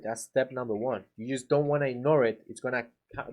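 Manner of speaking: ordinary delivery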